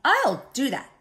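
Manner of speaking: high, loud mock screech; grouchy screech. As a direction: screech it like an indignant bug. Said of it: "screeched the grouchy ladybug".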